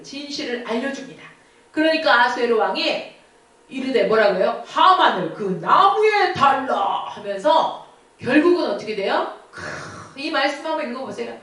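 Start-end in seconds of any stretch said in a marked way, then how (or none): 4.7-7.1 king's sentence. Acted. King orders execution.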